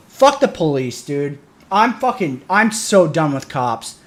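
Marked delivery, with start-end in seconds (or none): none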